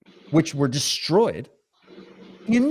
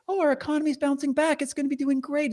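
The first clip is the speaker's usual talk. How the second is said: high, sing-song mock of a cheery minister; mocking Freeland; 10 semitones higher than usual. Host mocking the deputy prime minister's upbeat claim, high sing-song mockery. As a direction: ham up her upbeat spin, pitched way up. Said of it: "has the gall to come out and say".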